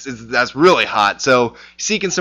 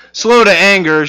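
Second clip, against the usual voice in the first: mock exasperation; 6 semitones higher, 7 dB louder than usual. Mock Jonah complaining to God.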